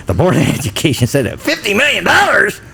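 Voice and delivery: incredulous sarcasm, pitch leaping up; mock outrage, voice shooting high on the figure; sarcastic. Shown as a demonstration. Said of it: "You see how different that is?".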